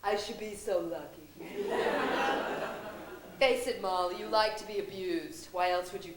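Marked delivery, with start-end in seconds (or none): 1.3-3.3 airless, higher mocking laugh. Friend laughing at Molly's love life, airless mocking laugh. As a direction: laugh at her without voice.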